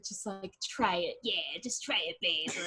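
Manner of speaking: soft, coaxing urging. Retold or imitated. Coaxing her partner to just try it, softly. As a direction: murmur him into trying, softly. Coaxing him to dirty talk.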